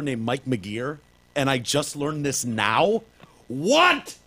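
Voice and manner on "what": much higher, louder cry of disbelief; shocked squeal. Real, not acted. Reacting to the news about [name]'s brother, a stunned high squeal. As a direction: squeak out what in pure shock.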